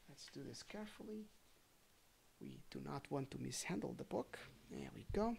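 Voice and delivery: soft careful murmur while handling the book; quiet aside to herself; hushed aside. Real, not acted. Reader handling a fragile book, hushed and soft.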